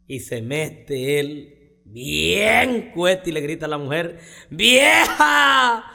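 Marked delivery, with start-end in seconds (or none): 4.5-5.8 loud, high calling out; drunken yell. Acted. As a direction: bellow for the wife from the doorway. Drunk husband yelling at wife. (borracho gritándole a la vieja)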